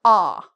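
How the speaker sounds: ah with the cheeks drawn in; rounded open ah. Shown as a demonstration. Teacher showing learners the right ah. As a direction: open wide but keep it inward. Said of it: "more inward".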